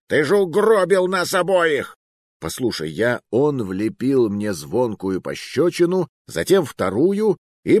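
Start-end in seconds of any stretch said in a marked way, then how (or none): 0.1-2.0 high-pitched furious outburst; roaring. A narrator's reading. Blaming his companion for dooming them both, roaring. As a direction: yell the accusation, pitch jumped right up. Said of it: "взревел Муруа".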